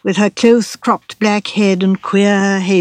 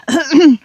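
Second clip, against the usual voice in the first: quick dry throat-clearing cough; dry little cough; 8 semitones higher, 5 dB louder than usual. A narrator's reading. Lawyer clearing his throat before correcting Raymond, dry cough. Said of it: "gave his dry little cough again".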